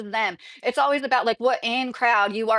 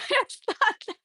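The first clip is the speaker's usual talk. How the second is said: high, slow words breaking into real laughter; laughing; 10 semitones higher, 4 dB quieter, slower than usual. Real, not acted. Speaker cracks up at the retold accent exchange, laughing. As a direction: crack up while trying to talk. Cracks up at her own imitation.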